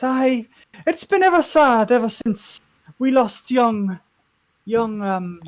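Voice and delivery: high, slow Welsh character voice; put-on Welsh accent. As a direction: lament in a singsong Welsh lilt. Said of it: "she's solidly Welsh now".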